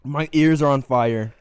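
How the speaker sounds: loud pained groan. Real, not acted. Suffering from hot food.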